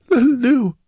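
put-on high, loud gasping girl's voice; gasped. A narrator's reading. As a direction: gasp it out high, in distress. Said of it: "she gasped".

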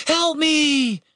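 high, long cry for help; high-pitched plea carried across distance. A narrator's reading. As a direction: call desperately for rescue. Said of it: "cried Catherine".

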